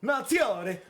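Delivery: high, loud shouted surname; yelled name. Shown as a demonstration. Host yells the member's surname again, loud shout. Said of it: "mad fun to fucking yell".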